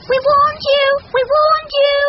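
very high, loud bird taunt; piping told-you-so chant; chorus of birds. A narrator's reading. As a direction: scold like squeaky little birds. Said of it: "chorused the birds".

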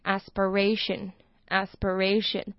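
aspiration said slowly with a clear ray sound. Shown as a demonstration. Teacher repeats aspiration for learners, slowly. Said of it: "A发ray的音".

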